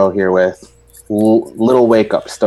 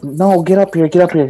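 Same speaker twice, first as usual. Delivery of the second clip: higher-pitched retold insistence; mock pleading, raised in pitch; imitated insistent demand; 7 semitones higher than usual. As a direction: act out a stubborn family demand. Retold back-and-forth between mother and son.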